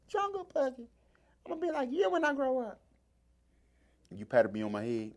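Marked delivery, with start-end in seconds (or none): 0.1-2.8 put-on much higher little-kid voice; imitating young kid self. A young kid telling his hero he'll be like him, high childlike voice. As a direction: talk up like a starstruck child.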